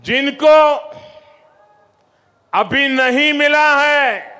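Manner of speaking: high, loud rally shout. Real, not acted. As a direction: call it out to the crowd. Rally speech to crowd.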